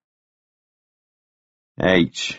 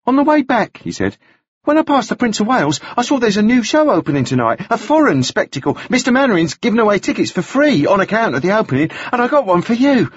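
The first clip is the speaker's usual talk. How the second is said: fast; 13 semitones higher than usual. A narrator's reading. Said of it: "He had spoken all of this very quickly".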